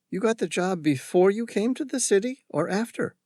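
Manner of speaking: lightly voiced young girl; curious coffee girl.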